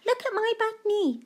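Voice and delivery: put-on high little girl voice; high-pitched child's "look"; child showing knee. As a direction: show off the knee like an excited child. Claire's repeated line.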